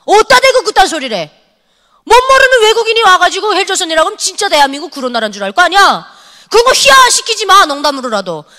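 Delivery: fierce scolding. Retold or imitated. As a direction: tear into the kid for mocking the country. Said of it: "엄청 혼내놨죠". (학생을 꾸짖는 격앙된 고함)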